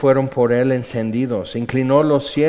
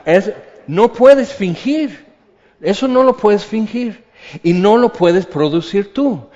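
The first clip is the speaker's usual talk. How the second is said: preaching at full force; 9 semitones higher, 7 dB louder than usual. Real, not acted.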